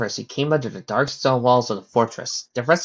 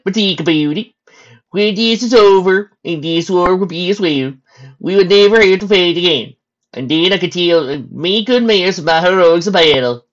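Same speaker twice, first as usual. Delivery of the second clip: loud, raised-pitch stallion voice; stallion soldier; 7 semitones higher, 9 dB louder than usual. Acted. Stallion soldier musing to his comrade on war's end, loud. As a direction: talk big in a high, loud character voice.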